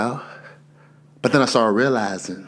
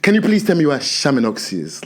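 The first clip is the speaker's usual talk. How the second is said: put-on African accent; 8 semitones higher than usual. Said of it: "my accent come out".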